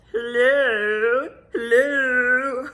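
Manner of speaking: high, loud, drawn-out mock phone hello; [name]'s weird voice. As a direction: put on a weird, wailing high voice. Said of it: "with this weird voice".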